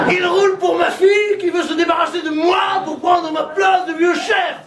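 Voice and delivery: hammed-up old politician panicking; paranoid Le Pen shout. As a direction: rave like a paranoid old chief. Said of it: "Le Pen".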